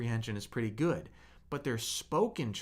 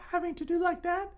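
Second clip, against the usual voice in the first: squeaky falsetto far higher; mock falsetto. Shown as a demonstration. Teacher shows students the wrong high pitch in a silly falsetto. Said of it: "not talking high pitch like falsetto".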